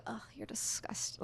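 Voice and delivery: hushed, whispered recoil of disgust; disgusted aside. Retold or imitated. Woman privately recoiling from her date, whispered disgust. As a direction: mutter it under your breath, repelled.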